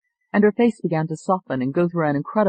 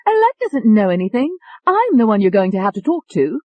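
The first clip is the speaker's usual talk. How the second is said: put-on higher, dismissive English-accented voice; English-accented Tony; 5 semitones higher, 6 dB louder than usual. A narrator's reading. Tony breaks in on the doctor, dismissive English accent. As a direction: cut in with a cocky English accent. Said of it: "There was that English accent".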